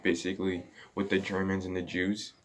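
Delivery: usual speaking voice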